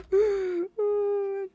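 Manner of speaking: sudden high surprised gasp; startled intake of breath; sharp gasp. Real, not acted. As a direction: gasp as it dawns on you. Realising the song's dedication.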